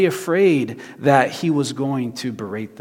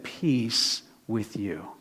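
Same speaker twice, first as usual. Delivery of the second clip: very slow, hushed, lower blessing; gentle reassurance; 4 semitones lower, 8 dB quieter, much slower than usual. Retold or imitated. God showing up in his fear, slow and tender. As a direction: soothe softly, drawing out each word. God's answer instead of scolding.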